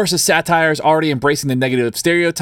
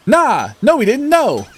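high, mock-indignant crowd protest; crowd's denial; 9 semitones higher than usual. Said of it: "The crowd says".

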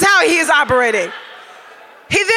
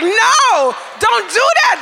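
panicked no; 10 semitones higher, slower than usual. Retold or imitated. Roommates frantically stopping the lights going off, panicked shout. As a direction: yelp it out in a panic.

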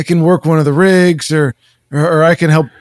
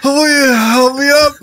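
pleading in pain, straining; getting up from chair; 4 semitones higher than usual. Acted. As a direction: beg for help, hurting. Man begging help from the ground.

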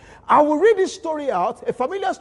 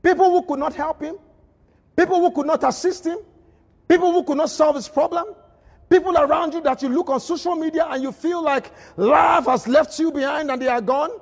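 preaching at full force; 5 semitones higher than usual. Acted. Rising preaching build.

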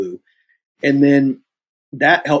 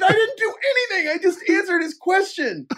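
very high mock-wailing squeal; retold protest. Actor defending himself to agent, comic wail. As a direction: whine out a hammed-up plea of innocence.

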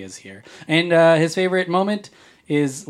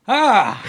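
startled yelp; 10 semitones higher, 7 dB louder than usual. Acted. Blindfolded arrow stunt.

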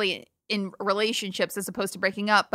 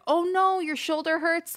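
sympathetic; 8 semitones higher than usual. Retold or imitated. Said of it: "I like, like".

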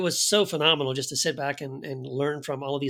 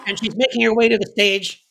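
announcer voice; 6 semitones higher, 7 dB louder than usual. Pretend. Mock award show commentary.

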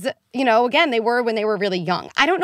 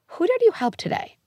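bright parent question; 13 semitones higher than usual. Pretend. Mock-asking a four-year-old.

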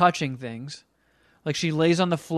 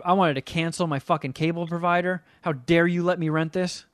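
usual speaking voice

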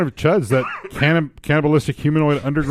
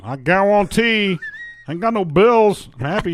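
Cajun chef impression; 6 semitones higher than usual. Joking about burned bills, as a Cajun chef. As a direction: play it as a happy Cajun cook.

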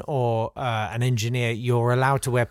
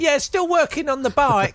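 high voice; 12 semitones higher than usual. Retold or imitated. Acted quote in dialogue.

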